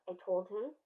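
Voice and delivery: ordinary delivery, nothing marked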